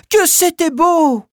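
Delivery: high, loud delighted cry; delighted child. Acted. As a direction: gush with delight. Children admire the dance.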